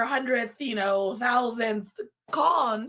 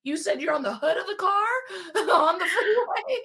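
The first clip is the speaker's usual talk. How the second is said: laughing disbelief; 5 semitones higher than usual. Retold or imitated. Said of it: "I wanna make sure I'm hearing this right".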